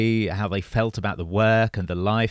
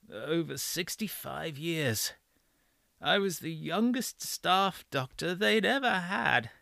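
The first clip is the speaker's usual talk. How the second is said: put-on frail breathless old person; 8 semitones higher, 9 dB quieter, slower than usual. A narrator's reading. Old doctor voiced in story.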